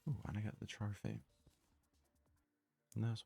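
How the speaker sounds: ordinary talk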